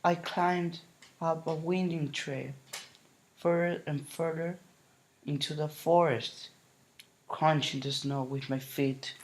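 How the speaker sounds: plain reading voice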